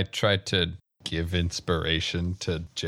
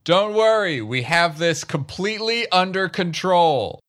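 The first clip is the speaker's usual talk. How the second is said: loud, high-pitched call; high, shouted in-character reassurance; shouted assurance; 13 semitones higher, 7 dB louder than usual. Acted. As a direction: holler it out to everyone, voice pushed way up.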